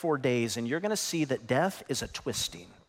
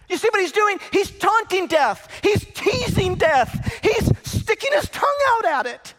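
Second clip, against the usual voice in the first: full-force preaching; 11 semitones higher, 5 dB louder than usual. Acted. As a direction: preach it out to the back row.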